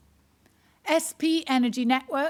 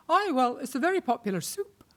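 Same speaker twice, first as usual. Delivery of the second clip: lightly voiced dry Scottish accent, unbothered; a hint of a deadpan Scottish old man; 3 semitones higher than usual. Old Scottish venue manager to the comedian, dry Scottish deadpan. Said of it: "that really dry Scottish way".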